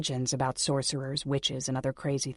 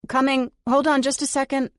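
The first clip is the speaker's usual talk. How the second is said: higher and louder, call through the door; 10 semitones higher, 7 dB louder than usual. Acted. Heroine calling to the maid at the door, loud and high. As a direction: shout it to the maid outside. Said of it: "I grunt".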